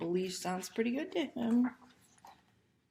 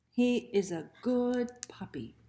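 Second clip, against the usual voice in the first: plain reading voice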